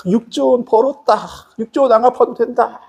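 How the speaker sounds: high-pitched acted glee; delighted relief. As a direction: celebrate like a debtor just let off. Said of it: "기분 좋게 오는데". (신이 나서 높은 소리로 기뻐하듯)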